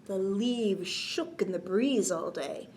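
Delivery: usual speaking voice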